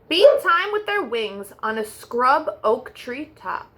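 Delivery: plain reading voice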